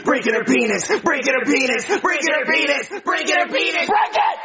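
building to a shout. Acted. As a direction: chant rising to a high yell.

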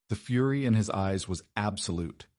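usual speaking voice

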